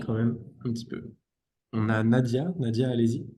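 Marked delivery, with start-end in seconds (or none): none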